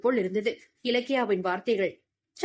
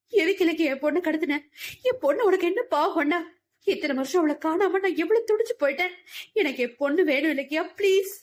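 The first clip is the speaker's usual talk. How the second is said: tearful plea; 6 semitones higher than usual. Acted. A mother begging the kidnapper for her child, in tears. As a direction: beg through tears for your daughter. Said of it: "அழுதபடி".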